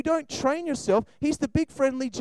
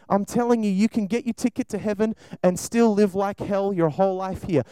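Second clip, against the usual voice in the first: ordinary talk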